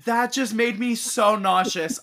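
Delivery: ordinary delivery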